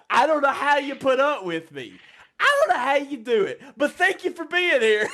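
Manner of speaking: high-pitched, laughing disbelief; laughing thanks. Real, not acted. Host thanking New York listeners for putting up with him, laughing. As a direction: squeeze the words out high through laughter.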